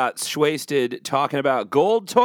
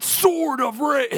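high, drawn-out title call; game announcer voice; 11 semitones higher, slower than usual. Acted. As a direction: attempt a big video game voice. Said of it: "I can't do the voice right now".

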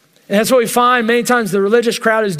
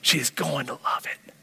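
inner thought; 4 semitones lower, 3 dB quieter than usual. Retold or imitated. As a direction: breathe it out as a private thought. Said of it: "and I'm thinking".

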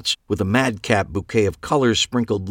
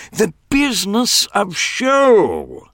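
high, slow, savoring words; grand showman; 12 semitones higher, 4 dB louder, much slower than usual. A narrator's reading. Clive proclaiming show business, high and slow. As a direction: roll it out like a marquee.

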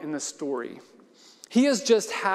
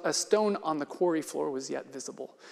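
normal talking voice

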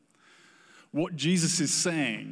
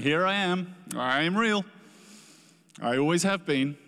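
higher and louder, a gentle but firm reply; raised, ringing voice of Jesus; Jesus answering; 5 semitones higher, 5 dB louder than usual. Acted. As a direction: speak as Jesus meeting the doubter. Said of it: "And Jesus is going".

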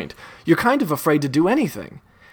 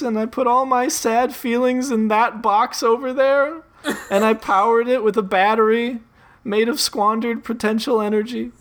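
hammed-up crying blob; 8 semitones higher than usual. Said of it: "The blob is straight up ugly crying".

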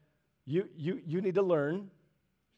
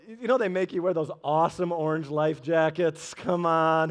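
usual speaking voice

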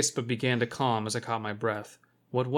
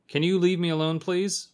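mildly polite plea, pitched higher; polite request; 6 semitones higher, 4 dB louder than usual. A narrator's reading. Narrator asks the creature to leave him alone, politely. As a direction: ask courteously, lifting the pitch. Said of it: "I asked politely".

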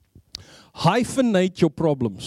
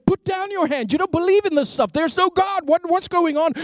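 higher, urgent protest of a doubting mind; inner voice protesting; 9 semitones higher than usual. Retold or imitated. Atheist's mind orders his hand down, high and urgent. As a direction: argue with your own raised hand, pitched up.

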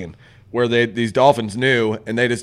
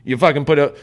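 ordinary talk

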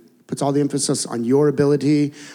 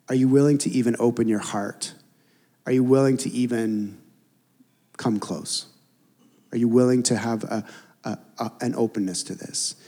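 gentle; 4 semitones lower, 3 dB quieter than usual. Retold or imitated. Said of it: "there's a gentleness where he's like".

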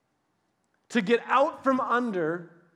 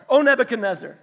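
laughing; 3 semitones higher, 8 dB louder, faster than usual. Real, not acted. Retrying the line, laughing.

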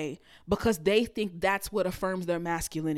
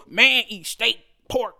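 louder, dumb macho voice from a cartoon; cartoon caveman guy; 8 dB louder than usual. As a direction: ham up a simple-minded macho man. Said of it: "have y'all seen that fucking skit".

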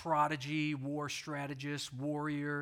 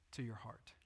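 whisper; 8 semitones lower, 13 dB quieter than usual. Real, not acted. Hushed whisper. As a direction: breathe it out in a whisper. Pastor softening to point inward.

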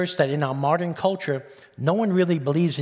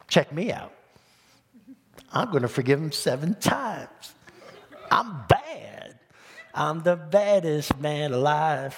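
cocky showing off, drawn out at the end; boastful swagger; slower than usual. Acted. Preacher imitates Peter showing off to Jesus, boastful. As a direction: brag like you're the baddest man alive. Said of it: "he was trying to be, trying to say".

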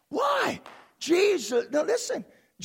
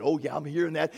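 the Father; 8 semitones lower, faster than usual. Acted. Voices the Father replying.

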